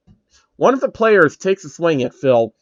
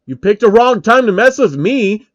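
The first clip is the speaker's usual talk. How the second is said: loud, higher menace; cocky threat; threatening; 7 semitones higher, 6 dB louder than usual. Acted. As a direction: warn him off. Phil threatens in fight.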